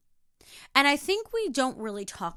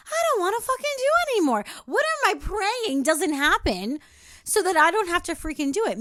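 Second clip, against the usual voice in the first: higher and louder, pushing through the frustration; exasperated rant; 8 semitones higher, 5 dB louder than usual. Real, not acted. Asking viewers why they do what they hate, exasperated. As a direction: vent it with real heat.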